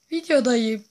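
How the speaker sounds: high loud shout; loud protest. Real, not acted. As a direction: cry out in frustration. Shouting while recording video. (yüksek sesle isyan)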